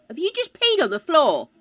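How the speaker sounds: high, loud, shocked outrage. Real, not acted. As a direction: gasp and demand in disbelief. Shocked at child peeing.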